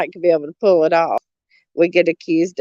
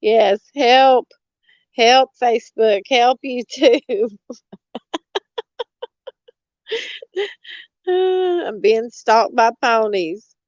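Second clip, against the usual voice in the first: mock plea; 5 semitones higher than usual. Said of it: "I'm gonna try to reenact it".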